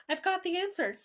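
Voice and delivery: high-pitched eager announcement; lifted, thrilled storybook voice; excited shout. A narrator's reading. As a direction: burst out with the big idea. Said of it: "I shouted".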